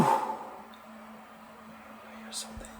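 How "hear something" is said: quick, nervous whisper; hushed and hurried. Real, not acted. Hiding, hearing footsteps outside.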